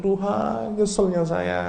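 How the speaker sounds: a high, pleading cry of regret; anguished plea; regretful lament. Shown as a demonstration. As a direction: cry out to God in remorse. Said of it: "itu saking".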